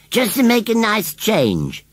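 high, slow airy scarecrow voice. A narrator's reading. Scarecrow explains his new clothes to John, airily. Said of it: "said Gummidge airily".

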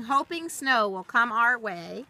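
ordinary delivery, nothing marked

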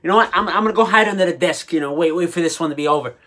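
ordinary delivery

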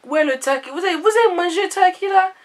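high, loud Ivorian auntie, indignant. Retold or imitated. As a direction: play the baffled auntie, pitch way up. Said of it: "She's like".

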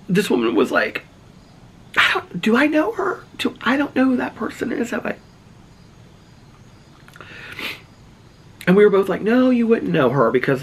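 very high, tearful voice; real emotional. Real, not acted. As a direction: keep telling it while choked up. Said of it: "I'm getting so emotional".

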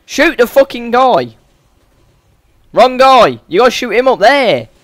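loud, high-pitched frustrated orders; exasperated shouting. Real, not acted. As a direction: yell instructions at a useless teammate. Yelling at partner to shoot.

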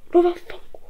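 high breathless whispered curse. Real, not acted. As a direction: hiss it under the breath. Same curse, dropped. (imprecazione sussurrata)

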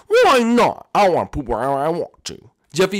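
ordinary talk

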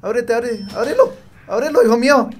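loud, high frantic begging; desperate pleading. Real, not acted. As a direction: plead with the door in panic. Begging door to open in panic.

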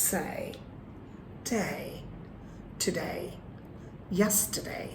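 soft, spaced words with gliding "day"; model pronunciation. Shown as a demonstration. Teacher giving words to repeat, soft careful pronunciation. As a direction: say "Say, day, today, yesterday" softly, each ending gliding. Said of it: "you pronounce after me".

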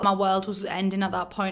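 ordinary delivery

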